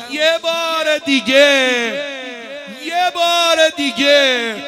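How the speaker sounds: high, drawn-out plea; pleading cry. Acted. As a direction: beg loudly and slowly, high-pitched. Acts Robab's plea to God.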